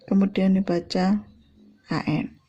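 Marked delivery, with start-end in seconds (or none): none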